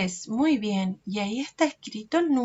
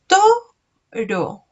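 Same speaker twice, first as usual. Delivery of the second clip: "Toro" as two separate beats, to high and loud; syllable by syllable; 5 semitones higher, 8 dB louder than usual. Shown as a demonstration. Teacher showing children how to count, "Toro" syllable by syllable. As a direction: punch to, pause, then ro. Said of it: "vamos a hacer así".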